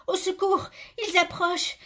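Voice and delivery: acted alarm, high and urgent; panicked cry for help. A narrator's reading. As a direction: call out as attackers close in. Victim hears attackers approaching in story.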